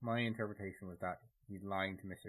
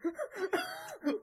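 high shrieking laugh; 8 dB louder than usual. Real, not acted. Reacting to accent impression.

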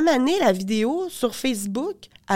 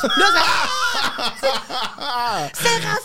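laughing; 7 semitones higher, much slower than usual. Real, not acted. Laughing through retold outrage.

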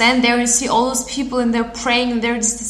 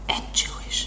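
hushed aside; whispered; 9 dB quieter than usual. Real, not acted. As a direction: whisper it under your breath.

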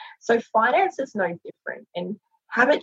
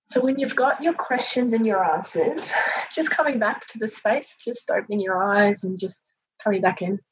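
soothing massage murmur. Acted. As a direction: ease them gently into breathing. Leads a guided breathing meditation.

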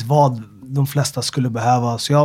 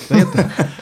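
asks his name with a laugh breaking through, pitched up; laughing quote; 5 semitones higher than usual. Retold or imitated. Retelling the gym encounter to the hosts, laughing. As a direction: laugh through the question as you replay it.

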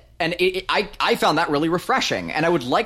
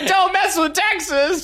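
high, slow, whiny and laughing; 3 dB louder, slower than usual. Shown as a demonstration. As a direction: whine it like a sulking kid. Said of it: "in kind of a whiny voice".

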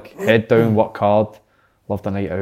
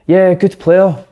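grudging praise; 6 semitones higher, 3 dB louder, much slower than usual. Retold or imitated. Eto'o grudgingly praising Messi.

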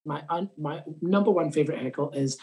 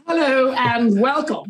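big, slow announcer hello, louder and higher; announcer greeting; 5 semitones higher, 6 dB louder, slower than usual. Pretend. Comedian jokingly opening the show for the podcast, hammed-up announcer. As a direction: open the show like a TV host.

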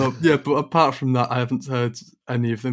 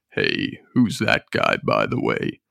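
deep [name] voice; slower than usual. Acted. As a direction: drawl it low like an old rocker. [name] voice again.

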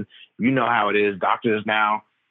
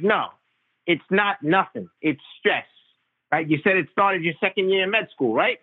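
pitched-up imitation of a blunt, know-it-all Long Island Italian doctor; 9 semitones higher than usual. Primary care doc scolding the patient about his cough, a higher, blunt imitation. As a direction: play the old family doctor, much higher, scoffing and certain. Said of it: "typical L-, Long Island Italian doctor".

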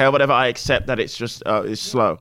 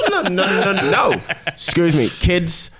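ordinary delivery

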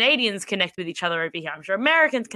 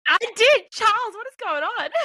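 high, loud startled reply; alarmed and amused at once; answering her cohost about the noise, startled and high; 14 semitones higher, 4 dB louder than usual. Real, not acted. Reacting to sudden noise.

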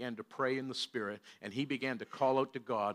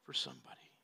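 whisper; 13 semitones lower, 11 dB quieter than usual. Real, not acted. Sermon point trailing into whisper.

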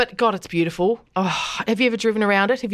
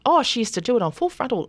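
normal talking voice

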